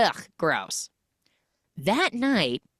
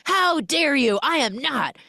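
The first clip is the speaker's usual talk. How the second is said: high, indignant mock protest; playfully outraged denial; 8 semitones higher, 3 dB louder than usual. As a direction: huff it out, offended. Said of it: "And she's like".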